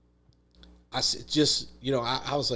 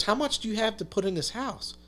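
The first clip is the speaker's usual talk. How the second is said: higher, pressing question; worried father; 9 semitones higher than usual. Retold or imitated. Contractor dad asking his son what he can put in, higher and pressing. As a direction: press him for the number.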